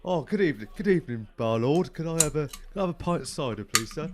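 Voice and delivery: loud, high Cockney pub customer; hammed-up Cockney punter at the bar; Cockney accent. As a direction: order cider in a put-on Cockney voice. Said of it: "is a Cockney one".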